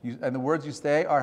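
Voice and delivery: ordinary delivery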